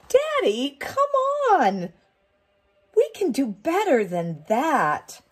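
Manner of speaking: put-on high child's protest; whiny little girl. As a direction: whine it like a fed-up kid. Daughter protests at the hat.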